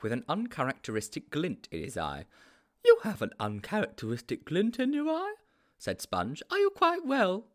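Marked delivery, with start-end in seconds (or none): 2.8-5.3 high-pitched, naive sidekick; high storybook character voice; Sponge's character voice. A narrator's reading. 6.5-7.5 high-pitched, naive sidekick; high storybook character voice; Sponge's character voice. A narrator's reading.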